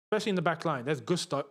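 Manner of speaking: ordinary delivery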